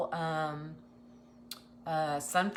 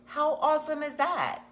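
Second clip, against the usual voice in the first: excited; 8 semitones higher, 5 dB louder than usual. Real, not acted. Hyping giveaway to viewers.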